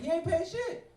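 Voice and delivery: high, loud indignant yell; hammed-up outraged outburst, pitch shooting up; outraged retort. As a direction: explode in disbelief at the waitress. Acted angry retort to waitress.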